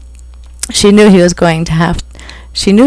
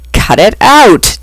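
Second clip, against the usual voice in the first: slow, louder shout; 4 semitones higher, 5 dB louder, slower than usual. A narrator's reading. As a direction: bark it out to stop the noise. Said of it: "Damon shouted".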